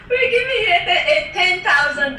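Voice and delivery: can barely get the words out for laughing. Real, not acted. Telling of the school's stipend offer, laughing through it.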